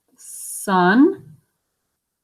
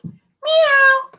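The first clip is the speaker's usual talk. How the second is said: cat meow; 13 semitones higher than usual. Acted. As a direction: mew like a cat. Cat speech bubble voiced.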